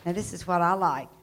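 ordinary talk, nothing marked

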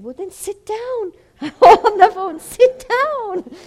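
loud laughing retelling. Retold or imitated. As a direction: laugh through the words, high and loud. Laughing while retelling his reply.

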